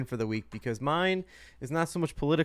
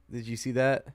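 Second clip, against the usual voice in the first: ordinary talk, nothing marked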